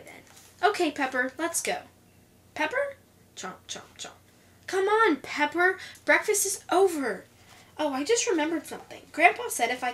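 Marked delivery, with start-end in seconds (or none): 2.5-3.0 high, rising questioning call; puzzled calling. Acted. Calls horse that won't move. 3.4-4.1 soft whispered chewing sound effect; whispered munching. A narrator's reading. Horse keeps munching breakfast. 4.7-7.2 high, insistent call to a horse; impatient urging. Acted. Coaxes horse away from eating.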